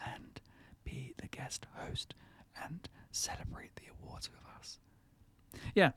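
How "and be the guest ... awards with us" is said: hushed unvoiced whisper; ASMR whisper. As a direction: whisper softly into the mic. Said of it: "why are we whispering".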